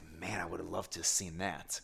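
softly whispered, wistful; whispered regret. Retold or imitated. Telling how he lost the big bonefish, whispered. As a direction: breathe it out under the voice.

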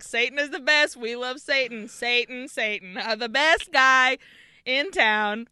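loud, gleeful mock chant; mock reciting. Imitating students praising Satan in class, loud mock recitation. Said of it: "just reciting, like".

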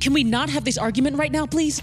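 fast, high, pressing plea to drop it; tense plea. Acted. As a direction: hurry her past the argument. Pleading mid-argument, wants to move.

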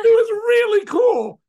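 high, loud and slow, overcome with feeling; moved exclamation. Real, not acted. Telling friends about the last dance, voice surging high and loud. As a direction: belt it out as the memory overwhelms.

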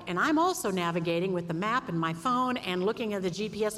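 normal talking voice

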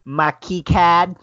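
put-on high, loud, slow Boston accent; drawn-out, hammed-up Boston vowels. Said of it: "my accent".

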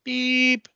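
high electronic beep; one sharp tone; machine beep. Acted. Answering machine tone.

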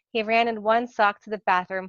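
plain reading voice

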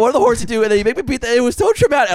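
high, loud mock anguish; hammed-up frantic wail; freaking out. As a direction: imitate someone reliving a trauma in panic. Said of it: "She starts freaking out".

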